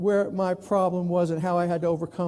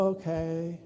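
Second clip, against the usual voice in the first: much softer, low deflated okay; bored okay; 4 semitones lower, 9 dB quieter than usual. Retold or imitated. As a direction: let it sag, bored. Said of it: "you kinda go".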